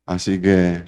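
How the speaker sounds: ordinary delivery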